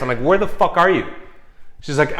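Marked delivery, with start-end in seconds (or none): 0.2-1.1 raised, louder retold alarm; agitated high-pitched questioning; urgent demand. Retold urgent phone call to ex.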